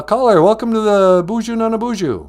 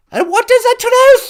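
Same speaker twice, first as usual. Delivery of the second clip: high comic British granny; high-pitched royal curiosity; Queen Mum; 6 dB louder than usual. Acted. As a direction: pipe up as a put-on old monarch. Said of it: "It's the Queen Mum".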